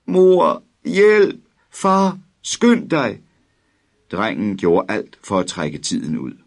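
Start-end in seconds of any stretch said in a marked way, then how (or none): 0.1-3.2 very high, loud cries for parents; screaming for help. A narrator's reading. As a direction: scream for mum and dad in terror. (en bange dreng skriger højt efter sine forældre)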